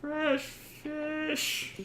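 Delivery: high, held-back street-vendor call; fish seller's cry. Acted. Cart seller calling from the main street, high mock shout. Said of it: "He's just shouting at the top of his lungs".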